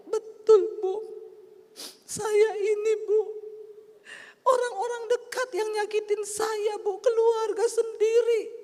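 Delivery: high, loud mock sobbing; wailed high with drawn-out words; tearful wailing. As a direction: imitate a woman crying out her hurt. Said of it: "dia nangis".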